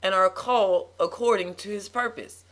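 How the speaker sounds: loud, high, emphatic preacher delivery; sermon voice rising in force; preaching. Real, not acted. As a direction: preach it in forceful, punched phrases. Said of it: "You preaching that thing".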